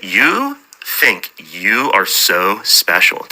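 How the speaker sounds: slow, talking down, in a voice not her own; condescending. Retold or imitated. Imitating his wife's condescending put-down, slow and superior. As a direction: drag it out like she's above you. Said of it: "she has that very downward talk".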